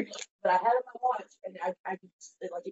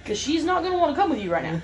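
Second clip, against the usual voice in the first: imitated friend advising, high and hurried; fast high-pitched cautioning; urgent warning; 7 semitones higher, faster than usual. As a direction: warn someone off in a retold phone voice. Friend warns caller off.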